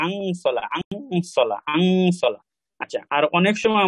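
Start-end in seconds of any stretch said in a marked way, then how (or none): 0.1-2.4 আংসলা with a nasal ং before স. Shown as a demonstration. Teacher drills students on the nasal in আংসলা. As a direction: repeat আংসলা, humming the ং into স. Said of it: "হবে আং".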